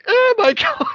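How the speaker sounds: high, loud shriek broken by a laugh; laughing mock terror. A grown-up retelling childhood fright in a high, loud, laughing shriek. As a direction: squeal it like a terrified kid. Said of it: "I'm like".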